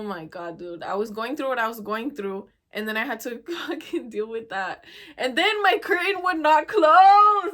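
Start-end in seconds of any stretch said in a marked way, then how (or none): 5.2-7.5 higher, louder, slow outburst; exasperated shout. Real, not acted. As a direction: yell it out, fed up. Venting about broken curtain.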